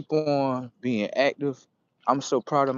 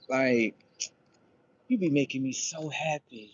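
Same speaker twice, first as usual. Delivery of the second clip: usual speaking voice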